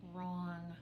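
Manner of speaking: usual speaking voice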